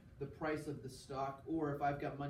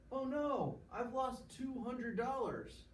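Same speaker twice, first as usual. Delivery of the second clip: higher, slow hammed-up dismay; mock dismay; 9 semitones higher, slower than usual. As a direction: play the shocked investor, drawn out and pitched up. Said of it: "I'm like".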